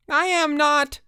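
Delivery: very high-pitched, indignant protest; scream. A narrator's reading. Jack Tyler denying he was tagged, screaming. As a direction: squeal the denial high. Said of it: "screamed Jack Tyler".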